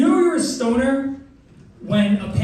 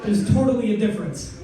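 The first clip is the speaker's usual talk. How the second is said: normal talking voice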